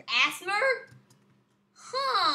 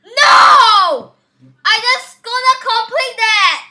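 loud, higher frustrated no; raging shout; 6 semitones higher, 14 dB louder, slower than usual. Real, not acted. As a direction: yell at the screen. Gamer loses progress.

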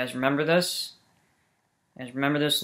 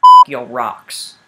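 a drawn-out, much louder curse at the rocks; angry outburst; 14 dB louder, much slower than usual. Real, not acted.

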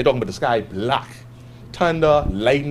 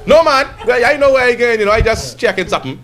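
high, loud, caught-out denial; defensive captain; 9 semitones higher, 7 dB louder than usual. Retold or imitated. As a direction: bluff like a captain who is lost. Captain denies being lost.

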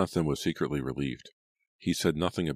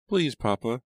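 put-on high, loud child's plea; begging; 9 semitones higher, 5 dB louder than usual. A narrator's reading. Abigail begs her father to let her go, pleading. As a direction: beg like a little girl. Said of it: "she begged".

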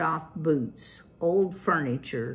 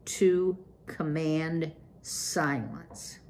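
plain reading voice

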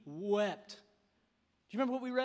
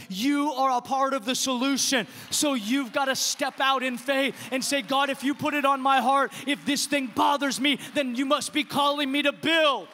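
louder, driving sermon delivery; rising preacher's exhortation; fervent preaching; 5 dB louder than usual. Acted. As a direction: push the congregation to act. Preaching builds.

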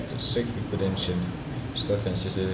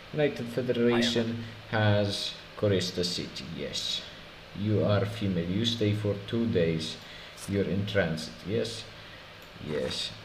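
mock Polish border guard accent; 4 dB quieter than usual. Inspector checking a traveler's papers, mock Polish accent. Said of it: "I can do a Polish accent".

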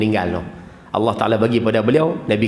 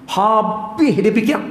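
slow high drawn-out; 8 semitones higher, much slower than usual. Shown as a demonstration. Preacher shows a husband thinking it over, drawn out and high. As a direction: stretch fikir out slowly and up high. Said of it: "Dia akan fikir".

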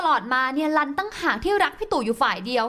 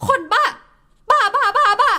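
high, loud outburst of rage; wife shrieking after husband hangs up; furious shout; 8 semitones higher, 6 dB louder than usual. Acted. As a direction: scream it at the dead phone. Wife yells after hang-up.

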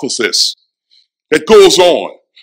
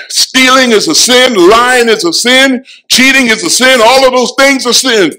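preaching at full force; 6 semitones higher, 4 dB louder than usual. Acted. Preaching build, louder.

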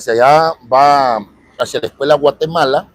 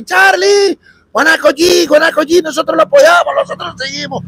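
imitating a fan calling out, much higher and louder; fan shouting; 13 semitones higher, 3 dB louder than usual. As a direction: play the excited fan hailing Charlie from the street. Fan shouting support in street. (fan emocionado gritando, voz mucho más aguda)

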